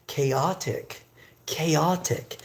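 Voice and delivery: "chaotic" carefully sounded as kay-OT-ik; pronunciation demo. Shown as a demonstration. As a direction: model "chaotic" with a hard K start, word by itself. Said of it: "It's pronounced like this".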